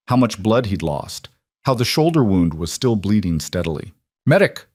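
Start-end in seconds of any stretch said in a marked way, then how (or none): 4.3-4.7 loud urgent call for a medic; shouting. A narrator's reading. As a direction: shout it across the room. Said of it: "Marco shouted".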